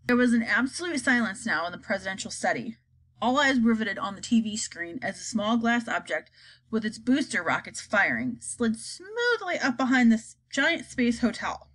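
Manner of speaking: usual speaking voice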